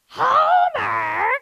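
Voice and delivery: high, loud nasal-free Marge cry of Homer; Marge impression. Shown as a demonstration. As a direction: shriek his name like a fed-up wife. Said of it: "Let me show you real quick".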